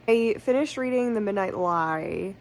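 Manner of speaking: ordinary talk, nothing marked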